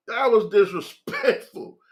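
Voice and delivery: ordinary delivery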